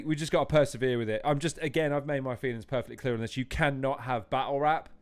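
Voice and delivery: normal talking voice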